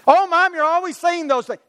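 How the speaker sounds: put-on high-pitched exasperated kid; complaining child. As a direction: complain like a kid fed up with Mom.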